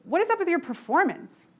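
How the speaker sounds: a boss snapping at a slipping employee; retold angry demand. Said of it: "because I'm pissed off".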